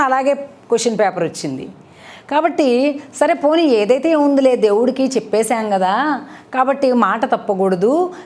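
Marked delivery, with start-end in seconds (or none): none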